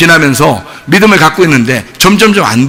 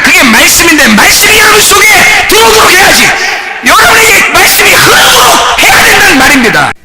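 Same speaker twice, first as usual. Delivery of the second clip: full-force preaching; 10 semitones higher than usual. Real, not acted. Preacher to congregation, shouting about the Word. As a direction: drive it home with a shout.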